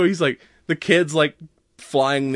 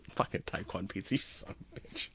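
breathless laugh; 4 semitones lower, 16 dB quieter than usual. Real, not acted. Groaning at a dad joke pun.

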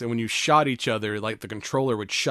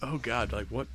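ordinary talk, nothing marked

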